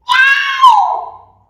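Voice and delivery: high, loud cat meow. A narrator's reading. Reading to a child, a loud high meow. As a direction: narrator meows as the cat Wilbur. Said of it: "said Wilbur".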